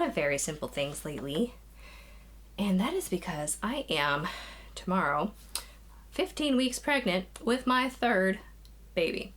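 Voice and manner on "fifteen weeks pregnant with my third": ordinary talk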